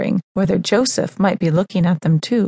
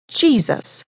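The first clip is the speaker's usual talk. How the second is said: a sharp, high call of his name; 6 semitones higher than usual. Acted. Mother calling out her son among the scholars, sharp and high. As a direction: snap his name to stop him. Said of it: "I said sharply".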